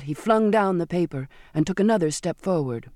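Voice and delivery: usual speaking voice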